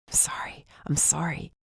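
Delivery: soft unvoiced whisper. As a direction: breathe the apology in a whisper. Comforting her after the crash.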